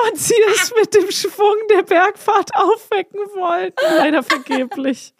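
high, squeaky voice breaking into laughter; helpless laughing. Real, not acted. Telling co-host the tragic rodent ending, helpless high-pitched laughing. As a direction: barely get the sad punchline out through laughs. Cracks up telling sad punchline.

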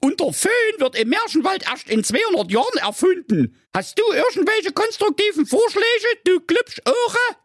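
put-on very high squeaky girl voice, complaining; fed-up fairy-tale brat in a piping voice; snippy princess Carola. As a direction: whine about the mess and demand help.

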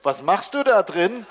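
put-on a kid wondering what mum is doing; curious child. A narrator's reading. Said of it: "Schnuffelinchens Stimme". (neugieriges Kind, höher und lauter)

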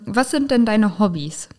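ordinary talk

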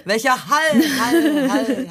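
high, repeated words like a fading echo; echo effect. Pretend. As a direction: imitate an echo bouncing back. Mocking echo of the word.

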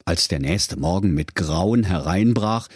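plain reading voice

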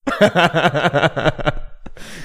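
high, loud laugh breaking out; can't hold back the laugh; burst of laughter. Real, not acted. Laughing at the parcel.

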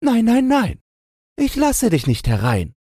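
high squeaky piglet voice; tiny high-pitched pig character; little pig calling back. A narrator's reading. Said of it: "rief es zurück".

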